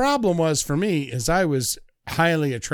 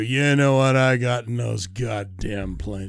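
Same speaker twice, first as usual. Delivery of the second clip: put-on lower, gruff old-vet grandpa, slowing down; imitating grandpa; 8 semitones lower than usual. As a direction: grumble like a cranky old grandpa. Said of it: "Was he like".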